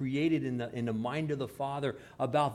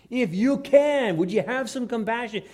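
passionate plea; 8 semitones higher, 8 dB louder than usual. Acted. Desperate father begging Jesus for compassion, passionate plea. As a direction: plead loudly as a desperate father. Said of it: "a real passion in his heart".

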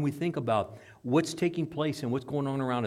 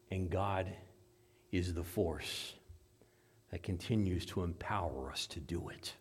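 low, soft, weighty pulpit delivery; hushed preaching; 6 semitones lower, 7 dB quieter than usual. Real, not acted. Preacher telling church God is the force, hushed preaching. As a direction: drop to a hush, leaving long pauses.

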